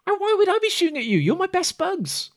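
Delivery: very high, rushed squeal of denial; indignant protest. Acted. Okus denying he shot at his best friend, high and indignant.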